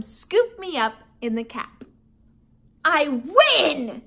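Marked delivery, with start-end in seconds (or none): 2.8-4.0 triumphant shout. Acted. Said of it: "he shouted triumphantly".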